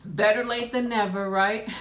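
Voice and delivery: ordinary delivery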